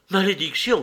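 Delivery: cursing cry. A narrator's reading. Man to the jeering woman, crying out a curse. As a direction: curse aloud, stung. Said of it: "cria-t-il".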